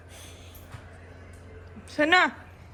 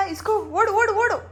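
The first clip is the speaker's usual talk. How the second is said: rising excited calls to run; excited calling; 10 semitones higher than usual. Real, not acted. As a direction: egg the dog on, climbing ever higher. Urging the dog to run.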